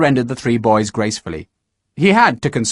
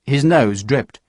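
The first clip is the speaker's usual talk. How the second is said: ordinary delivery, nothing marked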